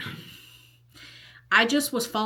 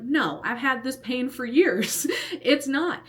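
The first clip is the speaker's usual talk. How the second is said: higher-pitched, drawn-out insistence, retold; vehement denial; 7 semitones higher than usual. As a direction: deny it hotly, replaying the argument. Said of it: "I denied it vehemently".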